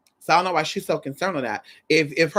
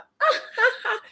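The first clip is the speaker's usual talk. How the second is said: very high dainty giggle; exaggerated feminine laugh; cunty laugh. Shown as a demonstration. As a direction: demonstrate a put-on girly titter. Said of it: "that sounds very cunty".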